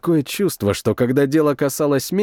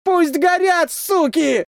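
furious yell; slower than usual. A narrator's reading. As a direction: spit the curse out screaming. Soldier yells at burning enemy.

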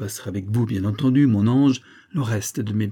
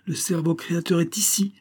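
exclaiming; 8 semitones higher than usual. Acted. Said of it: "s'écria le major".

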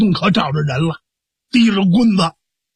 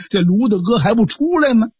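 ordinary delivery

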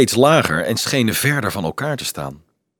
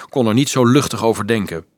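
usual speaking voice

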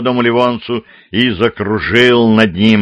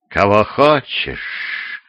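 slow, drawn-out demon of darkness; rustling demon voice; 3 dB quieter, much slower than usual. A narrator's reading. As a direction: stretch it out like a shadowy demon. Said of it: "зашелестел полой плаща демон тьмы".

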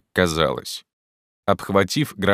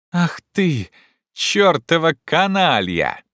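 high, slow, exclaimed curse; relieved shout; 10 semitones higher, 3 dB louder, slower than usual. A narrator's reading. Legrand curses Jupiter, shouting in relief. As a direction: cry out the insult with relief. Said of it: "закричал Легран с видимым облегчением".

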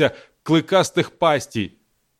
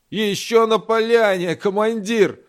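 put-on higher, eager old driver calling back; 6 semitones higher than usual. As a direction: shout back to the commander. Driver answers commander during firefight.

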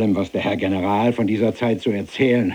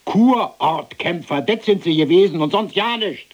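Kurortkämpfer langsam und laut ausgekostet; laut verhöhnend; 7 semitones higher, 3 dB louder than usual. Acted. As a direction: das Spottwort genüsslich auskosten. Höhnische Wiederholung, laut. (laut, langsam verhöhnend)

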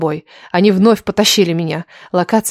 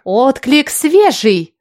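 drawn-out, higher-pitched accusation; mild hiss of indignation; 5 semitones higher, much slower than usual. A narrator's reading. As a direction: stretch it out slowly, higher and accusing. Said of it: "прошипела Лиля".

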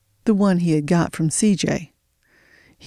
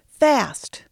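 high snapped command; shouted; 8 semitones higher than usual. A narrator's reading. The boss shouts at C J to hurry, high. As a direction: hurry him with a yell. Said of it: "he shouted to C J".

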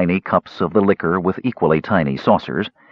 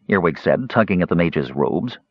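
ordinary delivery, nothing marked

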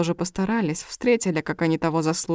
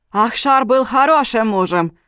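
loud, raised-pitch protest; indignant shout; 5 semitones higher, 7 dB louder than usual. A narrator's reading. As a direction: cry out in defence of him. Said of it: "закричал Алим". (возмущённый крик)

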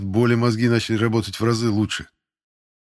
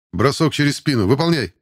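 higher, forceful instructor's command; military drill order; 5 semitones higher, 4 dB louder than usual. Acted. Instructor orders a recruit to throw him, forceful command.

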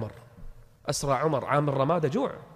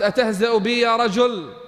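louder and higher, openly offended; indignant question; 6 semitones higher, 5 dB louder than usual. Acted. Old woman confronting the stranger, raised and indignant. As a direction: challenge him out loud.

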